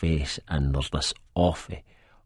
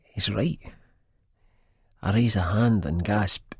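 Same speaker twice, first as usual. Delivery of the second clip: ordinary delivery, nothing marked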